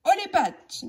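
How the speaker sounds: high, hopeful stick-up call; eager holdup line. Acted. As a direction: try to sound tough but too eager. Billy tries his holdup line.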